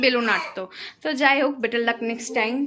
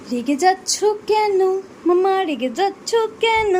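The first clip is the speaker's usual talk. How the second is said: higher, slow, tender coaxing; slow soothing; 6 semitones higher, slower than usual. Real, not acted. Soothing cranky baby.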